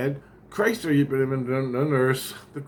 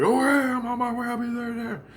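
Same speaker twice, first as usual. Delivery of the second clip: much higher, breezy voice; eager reply; 12 semitones higher than usual. Retold or imitated. Ringo answering the call, high and eager. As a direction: answer like someone already out the door. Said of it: "And he's like".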